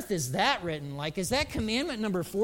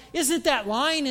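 higher, louder mock shock; scandalised question; 7 semitones higher, 5 dB louder, slower than usual. Preacher questioning his mentor's excuse, scandalised. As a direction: challenge him, pitched up in disbelief. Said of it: "and I'm like".

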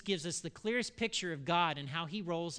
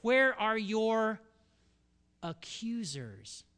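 slow questioning Jesus; 5 semitones higher, 6 dB louder, much slower than usual. Acted. Jesus asks the adulteress.

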